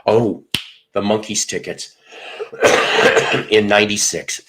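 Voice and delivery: ordinary talk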